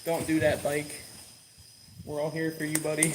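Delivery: soft voice soothing the bike; tender coaxing. Real, not acted. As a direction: coax it gently like a pet.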